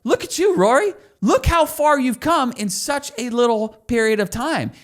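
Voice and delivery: cheering praise. Shown as a demonstration. Community owner cheering a new member's progress, high and excited. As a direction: sound like a proud coach celebrating progress.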